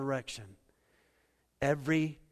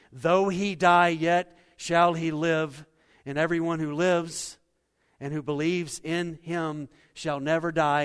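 louder and slower proclamation; preaching the scripture; 3 semitones higher, 8 dB louder than usual. Acted. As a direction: proclaim the promise to the church. Quoting scripture from the pulpit.

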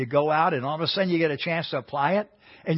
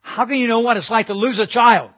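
higher, louder grieving anger; angry outburst; 7 semitones higher, 8 dB louder than usual. Retold or imitated. Grieving mother lashing out at the friend, angry and loud. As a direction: demand it of him in anger. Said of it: "the woman got really mad at him".

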